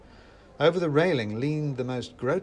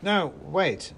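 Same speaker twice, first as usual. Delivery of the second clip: raised, urgent yell; higher, pleading shout; yelled protest; 6 semitones higher, 3 dB louder than usual. A narrator's reading. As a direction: yell to halt them. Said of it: "I yelled".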